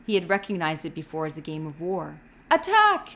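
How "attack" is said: loud, high shout of attack; battle cry. A narrator's reading. A child shouts from behind the storehouse to start the game, loud. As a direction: yell to charge the field. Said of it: "The shout came".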